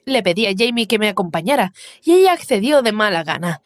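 ordinary delivery, nothing marked

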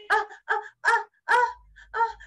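sneeze build-up. Acted. Bear's nose is tickled, acted sneeze build-up. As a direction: imitate a sneeze coming on. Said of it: "The bear was about to sneeze".

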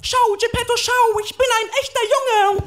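put-on very high, loud boyish cry; shouting puppet boy. Puppet tells Geppetto he is real, high shouting boy. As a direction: squeal it at full volume to your father. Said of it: "schrei aus voller Lunge".

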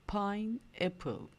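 "Pineapple" stretched out slowly; drawn out, one syllable at a time; slow model word. Shown as a demonstration. Teacher slowly modelling "Pineapple" for kids to repeat. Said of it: "Söyle bakayım".

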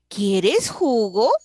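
pregunta lenta y fuerte, JUGO sube al final. Shown as a demonstration. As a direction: alarga «quieres jugo» y levanta mucho el final. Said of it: "Estoy preguntándote si quieres jugo". (pregunta bien marcada, con final ascendente)

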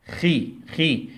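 slow drawn-out syllable khi, repeated; syllable demo. Shown as a demonstration. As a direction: stretch out خي slowly, kh then short i. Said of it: "مقطع جديد".

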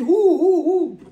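high ringing cry of Hou; crowd crying out. Retold or imitated. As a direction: call it out like the whole congregation. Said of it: "tout le monde crie".

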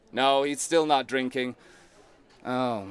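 ordinary talk, nothing marked

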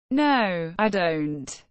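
normal talking voice

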